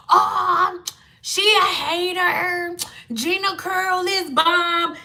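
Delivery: slow, dismissive voice; mock dismissive fan. Vlogger imitating women who called her a hater, dismissive.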